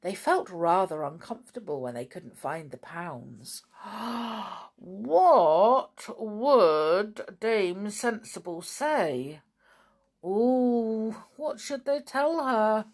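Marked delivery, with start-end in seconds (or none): 3.8-4.8 long dramatic intake of breath; alarmed storyteller's gasp; worried gasp. Acted. Goblins dread Dame Sensible. 4.8-9.4 slow, worried question; drawn-out, mock-fearful wondering; dismayed. Goblins dread Dame Sensible's reaction. 10.2-12.8 high, anxious wondering; dramatic storyteller's worry; worried. Acted. Goblins worry what to say.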